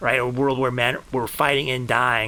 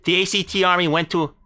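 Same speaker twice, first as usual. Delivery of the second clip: raised, ringing epic-saga narration over music; grandiose storytelling. Acted. Streamer recounting his heroic last stand, grandiose saga voice. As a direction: tell the battle like a legend of old. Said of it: "talking in a certain kind of voice".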